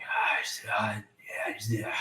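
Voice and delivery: unintelligible whispered rambling; whispering rambling. Acted. Game master voicing sounds behind a door, whispering. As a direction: whisper a ramble of half-formed words. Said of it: "unintelligible rambling in the whispering tone".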